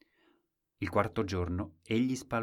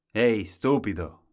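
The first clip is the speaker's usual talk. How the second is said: calling out; 8 dB louder than usual. Acted. King calls the boy.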